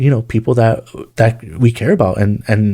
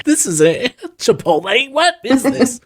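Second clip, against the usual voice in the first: much higher put-on voice, slowed; mock confused crowd member; 12 semitones higher, slower than usual. A passerby at the soapbox complaining it isn't Chipotle, in a high squeaky voice.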